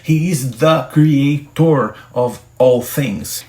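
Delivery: "He is the creator of all things" spoken slowly and clearly in English; slow English model. Shown as a demonstration. Teacher slowly models an English sentence for students. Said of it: "Ou você pode dizer".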